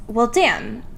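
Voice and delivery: ordinary talk, nothing marked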